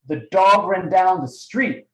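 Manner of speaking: slow build to a stressed "street". Shown as a demonstration. Coach demonstrating tonality to trainees, stress on "street". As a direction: land the emphasis on "street" at the end. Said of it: "emphasis on the street".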